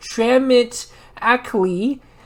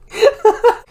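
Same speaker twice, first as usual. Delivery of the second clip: loud high burst of laughter; real laugh; 6 dB louder than usual. Real, not acted. Reader losing it at the story, loud laugh. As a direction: crack up helplessly.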